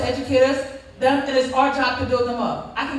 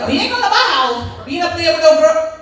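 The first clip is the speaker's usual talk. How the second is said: louder, higher imitated mom rant; indignant mother; 4 semitones higher, 7 dB louder than usual. Mother refusing to have her son home, imitated loud indignation. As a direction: lay down the law as a mock fed-up mother. Voices what the kid's mother would say.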